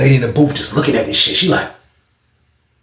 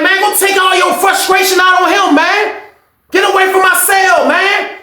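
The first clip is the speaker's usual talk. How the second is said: very high-pitched yelling; hammed-up exasperated inmate; acted shouted dismissal; 3 dB louder than usual. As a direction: yell at him to back off.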